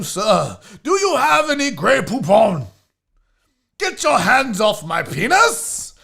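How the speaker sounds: indignant English gentleman, high and loud; hammed-up English outrage; English accent. Host voicing a dapper shelter cat, haughty English accent. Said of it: "Your English accent is better".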